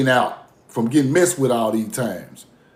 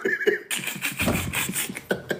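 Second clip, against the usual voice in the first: soft airy laugh; breathy laugh; 10 dB quieter than usual. Real, not acted. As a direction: laugh quietly to yourself. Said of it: "I'm laughing at them".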